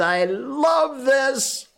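very high, drawn-out relish; gushing delight. Real, not acted. Host relishing an odd old word, gushing delight. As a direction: stretch it out slowly with glee. Host gushes over the word's origin.

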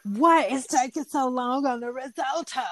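furious chef. Retold or imitated. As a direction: snap at the cook like a head chef. Voicing Gordon demanding risotto.

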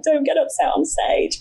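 high-pitched mock pep talk to oneself; worried self-warning. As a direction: plead with yourself to hold it together, pitched high. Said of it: "I was probably gonna get upset".

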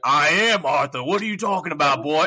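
a light retold cowboy impression; Arthur Morgan voice. Voice actor role playing to kids, a lightly voiced cowboy outlaw. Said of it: "a spot on Arthur Morgan impression".

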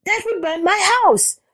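very high rabbit wail; alarmed cry. A narrator's reading. Rabbit fearing for the house, high wail. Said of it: "cried Rabbit".

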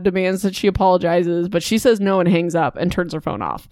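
usual speaking voice

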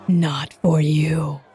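slow, low ancient tree voice; a deep groaning tree speaks slowly; talking tree. Acted. Tree creature speaks to player.

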